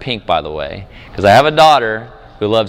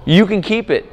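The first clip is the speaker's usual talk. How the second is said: reassuring dad; 8 semitones higher, slower than usual. Retold or imitated. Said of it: "I'm like".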